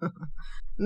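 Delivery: soft, low acted laugh; short laugh in character; amused chuckle. A narrator's reading. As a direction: chuckle quietly before replying. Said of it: "ضحك وقال".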